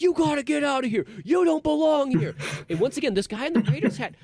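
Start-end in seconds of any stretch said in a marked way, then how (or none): 0.0-2.3 high, loud mock outrage; shrieky retold freak-out. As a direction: imitate territorial ladies ordering someone out. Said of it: "they're freaking out like".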